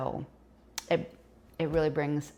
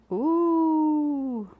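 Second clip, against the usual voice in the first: gasp, then a long high "ooh"; high, drawn-out ooh after a quick gasp; delighted gasp and ooh; 9 semitones higher than usual. Real, not acted. Baker pulls a biscuit out, gasping ooh.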